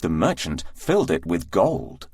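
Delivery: ordinary delivery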